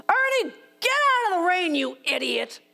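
sharp high yell, full of rage; raging yell at the dog. Retold or imitated. Woman losing her temper at her dog in the rain, raging yell. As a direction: snap at the dog out of pure frustration. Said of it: "I do not talk to my animals that way".

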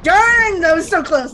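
high, loud cry of frustration; frustrated outburst. Real, not acted. Player who nearly won the fight, frustrated high yell. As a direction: burst out at a near miss. Just missed in game.

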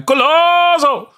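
very high, slow screaming "Golazo!"; mock commentator scream. Shown as a demonstration. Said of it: "sit here going".

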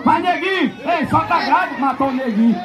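ordinary talk

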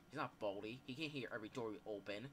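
ordinary talk

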